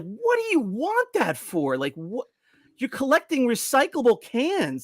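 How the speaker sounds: baffled, can't believe it; incredulous. Retold or imitated. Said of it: "I'm like".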